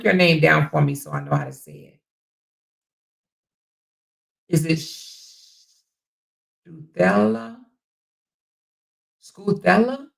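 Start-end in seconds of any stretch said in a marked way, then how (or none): 4.5-5.7 "Sh-" drawn out slowly, then broken off; tentative pronunciation. Real, not acted. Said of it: "I don't wanna mispronounce it". 6.7-10.1 "Scuthella" higher, as a question; trying out "Scuthella" on a rising pitch; tentative pronunciation. Real, not acted. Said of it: "Tell me how you say your name".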